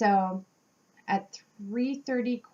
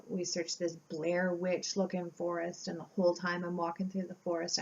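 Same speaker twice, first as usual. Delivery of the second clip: ordinary delivery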